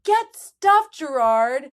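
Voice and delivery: high, loud mock retort; yelling back. Host acts Claire answering Gibsie across the field, yelling back. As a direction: holler a rebuff across a distance. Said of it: "her yelling back".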